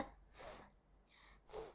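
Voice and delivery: "th" as air only, no voice; just the voiceless "th" hiss between the teeth; devoiced TH. Shown as a demonstration. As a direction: say "th" alone, without any voicing. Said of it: "And the devoiced one".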